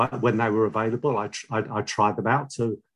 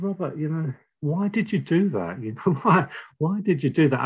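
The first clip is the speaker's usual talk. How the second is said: higher-pitched, pained questioning of a friend; retold reproach; 6 semitones higher than usual. Betrayed friend confronting him at the party, retold reproachfully. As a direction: imitate a wounded friend asking for an explanation.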